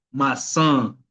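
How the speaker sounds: "maçã" with a nasalised final vowel; nasal vowel. Shown as a demonstration. Teacher giving an example of the tilde, nasal ending on "maçã". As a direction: let the end of "maçã" ring through the nose. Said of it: "con un sonido nasal".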